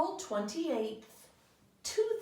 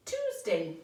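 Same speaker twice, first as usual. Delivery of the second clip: normal talking voice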